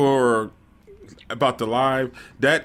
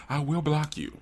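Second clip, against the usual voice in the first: deadpan, measured warning; very straight voice; 7 dB quieter than usual. Real, not acted. Host warning fans who ask rude questions, very straight voice. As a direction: state the threat completely straight. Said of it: "said that with a very straight voice".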